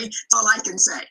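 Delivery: ordinary talk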